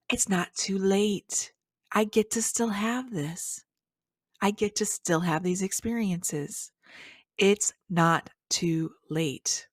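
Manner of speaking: gasped-in, slow wonder; awed relief. Acted. Speaker voicing happiness at getting a second chance, awed relief. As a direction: let it dawn on you slowly, drawing out each phrase. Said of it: "It's that feeling of".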